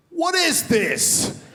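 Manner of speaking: put-on high, slow child's outraged question; high drawn-out kid voice; disgusted kid. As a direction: sound like a five-year-old let down by his hero. Five-year-old son disgusted.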